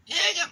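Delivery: high, loud squeal of disgust. Acted. Reading to a child, milk all over the piglet, squealing.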